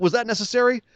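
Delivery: high, mildly mock-indignant question; mock disbelief. Kids scolding the rough playmate, high mock disbelief. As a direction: play up the kids' disbelief. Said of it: "everyone kinda looks at them and go".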